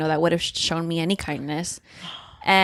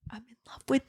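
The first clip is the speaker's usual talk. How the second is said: soft, breath-only love confession; whispered confession; 3 semitones higher, 8 dB quieter, faster than usual. Retold or imitated. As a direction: whisper it, as if barely daring. Acts out character's confession, first take.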